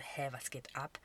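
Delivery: a baffled whisper; soft, voiceless bewildered aside; confused whisper. Retold or imitated. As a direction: whisper it under your breath, puzzled. Retold confused thought on missed calls.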